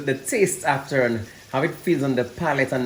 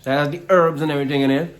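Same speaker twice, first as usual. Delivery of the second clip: usual speaking voice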